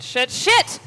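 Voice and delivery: sudden high, loud yelp of frustration; frustrated yell. Real, not acted. Gamer yelling as her character gets killed on the stairs, sharp loud yell. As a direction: blurt it out loud as you get caught.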